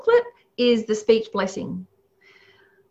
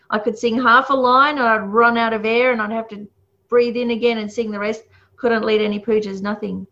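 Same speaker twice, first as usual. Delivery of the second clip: ordinary delivery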